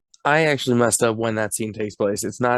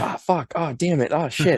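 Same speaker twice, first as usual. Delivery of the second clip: higher-pitched imitation of getting hit; mock pained cursing; 5 semitones higher than usual. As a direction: act out little cries of pain. Imitates men yelping when hit.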